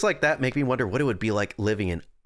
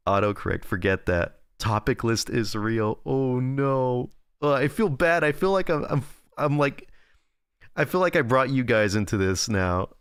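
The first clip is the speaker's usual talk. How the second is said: usual speaking voice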